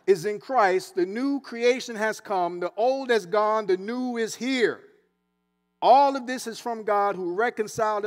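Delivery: loud, measured scripture read; preacher reading the word aloud. Acted. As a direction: proclaim the verses to the church. Reads scripture aloud with force.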